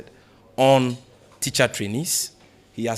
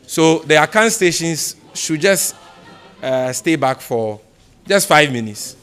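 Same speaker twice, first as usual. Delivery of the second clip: raised voice to the room, slowed; louder announcement to reporters leaving; calling out; 4 semitones higher, 9 dB louder, slower than usual. Real, not acted. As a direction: call over the crowd to the stations. Calls out to Akan stations.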